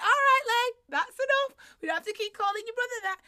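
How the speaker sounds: parent stepping in. Retold or imitated. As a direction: stop the child, half-laughing. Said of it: "I walked in and I went".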